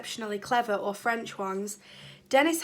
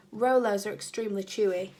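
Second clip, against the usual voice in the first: ordinary delivery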